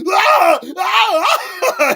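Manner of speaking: loud, high-pitched cartoon scream; imitated Homer screams. Podcaster retelling a Simpsons clip, imitated screaming. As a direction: scream in bursts, imitating a panicked cartoon character. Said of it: "he starts screaming".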